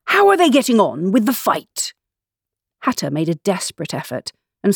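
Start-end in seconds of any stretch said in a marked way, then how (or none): 0.0-1.9 raised, higher-pitched demand; crying out. A narrator's reading. King asking Hatter about the fight, crying out. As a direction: call the question out insistently.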